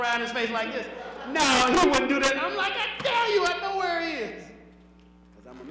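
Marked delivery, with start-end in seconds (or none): 1.3-2.4 loud mock-indignant denial; loud protest. Woman defends her man to the comic, loud protest. 2.7-4.4 high, loud insistence; emphatic retort. Retold or imitated. Comic insists to a doubting woman, high emphatic retort. Acted argument back at the woman.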